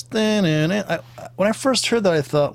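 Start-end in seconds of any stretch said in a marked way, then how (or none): 0.0-0.8 higher two-tone siren sung as dun dun; playful siren noise, pitched up; imitated European siren. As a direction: mimic a European ambulance siren. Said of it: "European ambulance".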